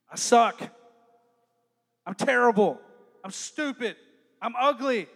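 loud, bitter self-talk; loud self-insult. Retold or imitated. Teen berating themselves, loud and bitter. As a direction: spit out the insults.

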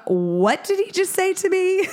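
high, stunned indignant question; retelling her own shocked outrage; indignant disbelief. Retold or imitated. Indignant retold thought.

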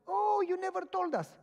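high, loud surprised exclaim. Retold or imitated. Vet learns the visitor is a pastor, surprised. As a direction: sound caught off guard. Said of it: "I'm a pastor".

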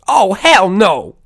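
high, loud, slow mock outrage. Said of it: "[name] would always go like".

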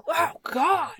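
high, loud imitation of a recoiling mother; appalled mom. Daughter imitating mom hearing ASMR, loudly appalled. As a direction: imitate mom's loud disgusted outburst.

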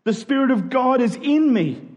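ordinary talk, nothing marked

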